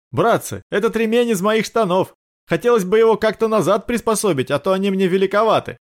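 high-pitched prisoner voice; prisoner pleading lightly. Acted. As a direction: call to the guards in a high voice.

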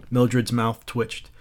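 ordinary delivery

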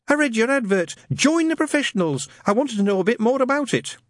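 high, polite, timid lad; nervous young man. Acted. Newton asking Shadwell about the ad, timid and high. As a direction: ask shyly, voice up.

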